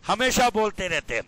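ordinary delivery

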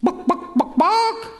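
high squawky chicken book. Acted. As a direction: cluck it like a hen asking. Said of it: "the chicken's back".

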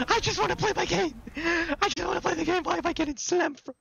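high, whiny rage scream; frustrated shriek. Real, not acted. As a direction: wail it out in frustration.

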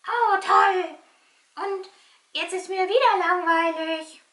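puppet sighing it's boring again; bored puppet. Pretend.

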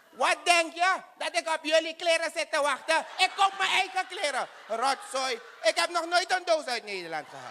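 high, indignant shouting at the clerk; offended yelling. Acted. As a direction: bristle at the mangled name, yelling high. Passenger shouts about clothes. (hoog en verontwaardigd schreeuwen tegen de baliemedewerkster)

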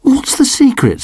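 high, eager question; little dog begging his dad to tell; curious pup. A narrator's reading. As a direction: ask it wide-eyed and hopeful. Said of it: "Sid replied".

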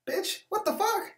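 high, slow, stunned outcry; shocked outburst. Retold or imitated. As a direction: squeal it in shock. Shocked reaction quote.

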